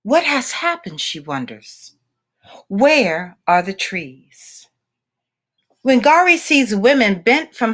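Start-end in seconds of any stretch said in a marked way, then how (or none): none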